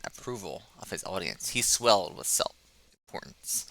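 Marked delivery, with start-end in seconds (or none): none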